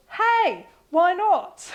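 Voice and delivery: high, loud, playful mock voice; put-on voice for the writer. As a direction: pipe up with an exaggerated quoted exclamation. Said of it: "I don't know if that's your voice".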